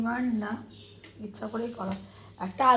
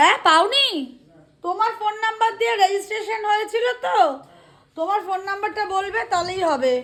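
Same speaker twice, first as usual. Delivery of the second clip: raised, high call across the house; calling out loud; 10 semitones higher, 6 dB louder than usual. Real, not acted. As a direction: project the voice to someone far off. Talking loudly to father over phone.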